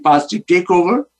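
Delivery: ordinary delivery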